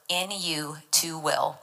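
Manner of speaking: slow, deliberate "in you to will", each word separate; stress on "in you to will". Shown as a demonstration. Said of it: "Emphasis on the words".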